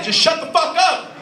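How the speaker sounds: high, loud outburst of impatience; exasperated shout. Acted. Customer fed up with the chatty attendant, exasperated shout. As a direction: yell to cut off the chatter.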